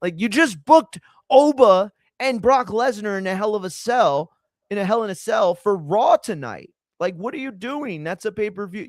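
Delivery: high, loud panicky exec voice; panicked executive. Retold or imitated. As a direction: play a rich exec freaking out. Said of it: "they might panic".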